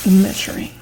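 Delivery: ordinary delivery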